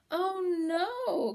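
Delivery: high, loud dramatic wail. A narrator's reading. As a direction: cry it out like a doomed little bug. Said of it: "Crickwing wailed".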